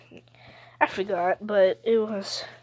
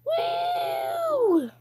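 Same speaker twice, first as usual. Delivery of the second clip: very high, long woo; excited squeal. Real, not acted. Player flying through the game, excited squeal. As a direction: squeal it high and let it slide down. Whooping while playing a game.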